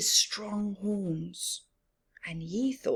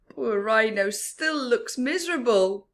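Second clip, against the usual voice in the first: ordinary delivery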